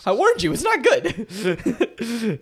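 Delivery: drawn-out, high laughing admission; amused I-told-you-so breaking into laughs. Real, not acted. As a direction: say it through helpless laughter. Laughing at own failed accent.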